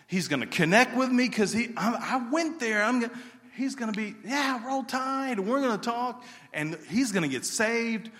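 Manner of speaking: high, loud, rising hype; excited daydream. Retold or imitated. Young Bible-school grad imagining a bus revival, high loud excitement. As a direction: build the fantasy bigger and bigger. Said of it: "And I think".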